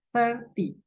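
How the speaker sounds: thirty pitched high, stress on thir then falling. Shown as a demonstration. Teacher contrasts with thirteen, stress on thir. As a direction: hit thir in thirty and drop. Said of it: "penekanannya di ter".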